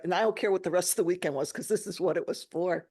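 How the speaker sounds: ordinary talk, nothing marked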